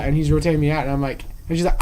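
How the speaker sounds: ordinary delivery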